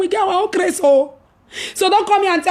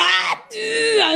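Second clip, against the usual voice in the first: cry of relief; 8 semitones higher than usual. Retold or imitated. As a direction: let it out like a burst of relief. Relief outburst outside.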